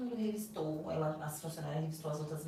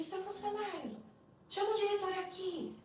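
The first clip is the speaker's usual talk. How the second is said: much higher voice, imitating an indignant summons; Eduardo, retold angry demand; 12 semitones higher than usual. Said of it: "ele era muito estressado, bravo, briguento".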